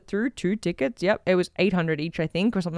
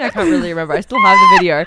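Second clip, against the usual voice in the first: usual speaking voice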